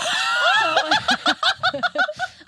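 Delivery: high shrieking laugh; loud laughter. Real, not acted. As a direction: burst out laughing. Big laugh heard.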